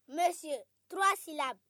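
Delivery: ordinary talk, nothing marked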